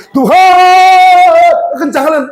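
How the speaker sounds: loud, high, long-drawn cry to God; shouted prayer. Shown as a demonstration. Preacher demonstrates crying out to God on a motorbike, shouted prayer. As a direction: bellow the plea and stretch out the vowels. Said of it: "Berteriaklah di kamar tidurmu".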